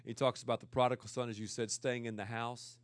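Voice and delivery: ordinary talk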